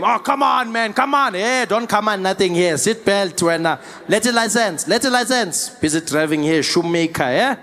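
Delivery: put-on animated South African accent banter; South African bargaining. South African traffic stop game, animated accented arguing. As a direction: haggle with lilting South African protests. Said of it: "immediately you're in the game".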